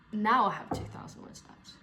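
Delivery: usual speaking voice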